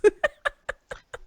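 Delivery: bursts into high laughter; sudden high-pitched laugh. Real, not acted. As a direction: laugh out loud at the joke. Laughing at joke.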